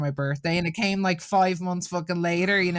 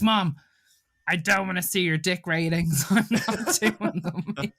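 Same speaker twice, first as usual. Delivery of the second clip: laughing through daughter's quote; 3 semitones higher, slower than usual. Retold or imitated. Mother retells daughter's refusal, laughing throughout. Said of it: "She's like".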